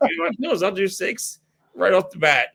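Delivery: high, bold mock boast; hammed-up cocky swagger about pills; cocky bravado. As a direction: show off like you can't be stopped. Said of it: "I'm like".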